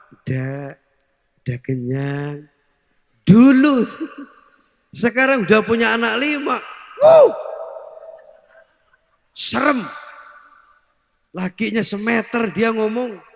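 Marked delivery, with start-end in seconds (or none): 0.1-2.5 softer, lower, slow shy refusal; coy young wife. Retold or imitated. Wife coyly declining food while courting. 7.0-9.9 a sudden high, loud startled whoop; mock shock. Mock shock at five children.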